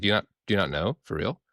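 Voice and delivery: ordinary talk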